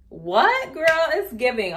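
excited exclamation at the camera; delighted surprise. Real, not acted. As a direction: react like you just saw something gorgeous. Surprised by cinematic mode look.

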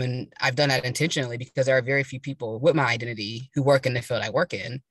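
usual speaking voice

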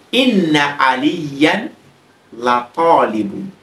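inna held with a doubled n, la talibun apart; slow Arabic example. Shown as a demonstration. Teacher giving the emphasised sentence, slow. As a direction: spell out the example slowly. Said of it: "I will simply say".

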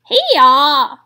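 loud braying bleat; bleating sheep voice. Acted. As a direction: bray it out like a sheep. Lambs answer in chorus.

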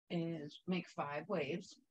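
usual speaking voice

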